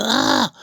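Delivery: high drawn-out "ah"; acted high-pitched groan of effort; straining cry. Mimes reaching deep inside.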